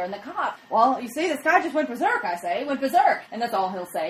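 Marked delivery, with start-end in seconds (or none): 0.7-3.2 put-on loud old-timey cop voice, higher; old-fashioned policeman blustering. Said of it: "give old-timey voices".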